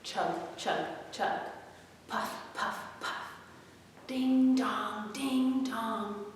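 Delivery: chug, then puff in a soft whisper, then ding dong; train noises. Acted. Storyteller making train sounds for the kids, softly.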